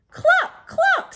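very high, loud hen cluck; clucking cow. Acted. Teacher voicing Cow for her class, high loud clucking. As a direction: cluck like a chicken. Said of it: "said Cow".

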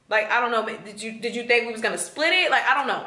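ordinary talk, nothing marked

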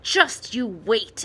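a small tree's slow, furious promise; furious muttered threat. A narrator's reading. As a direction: grit out the threat with bottled-up fury. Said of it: "The fir tree trembled with fury".

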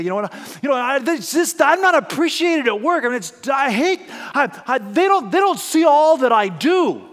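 high, loud aggrieved complaining; victim story. Retold or imitated. Preacher voicing a worker's victim story, high loud grievance. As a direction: whine like an unappreciated worker. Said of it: "it might sound like this".

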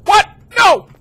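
very high, loud cries of disbelief; panicked shout. Real, not acted. Player caught by the monster, panicked shouting. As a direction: scream in desperate protest.

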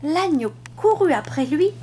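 plain reading voice

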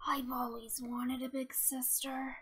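low, soft admission; quietly wistful wish; soft confiding. Acted. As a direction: confide it softly, pitch dropped. Shy confiding to new friend.

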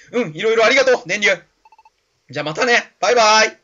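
high, loud, grateful goodbye; cheerful game hero. Acted. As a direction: call out a bouncy farewell. (高く元気な声で別れを告げる主人公)

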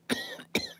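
sharp cough after swallowing wrong; choking cough. Real, not acted. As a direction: cough hard, something went down wrong. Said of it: "That went down the wrong pipe".

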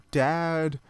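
long, high-pitched yell. A narrator's reading. Daughter on the silo ladder calling dad, yelling. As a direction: stretch the name out in a yell. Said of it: "I yelled as I climbed up the ladder".

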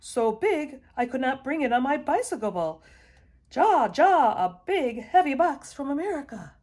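loud, high-pitched shouts; excited shouting. A narrator's reading. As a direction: shout the big news to all the neighbours. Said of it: "he shouted".

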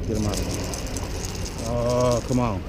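ordinary talk, nothing marked

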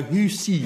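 "Husi" for house, said HOO-see; deliberate mispronunciation. Shown as a demonstration. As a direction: say house absurdly as "Husi", two syllables. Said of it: "if I want to say house".

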